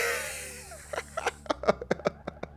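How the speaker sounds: high, voiceless wheezing laugh; breathless wheeze of laughter; real laughter. Real, not acted. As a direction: crack up helplessly, barely voiced. Cracks up at snake faces.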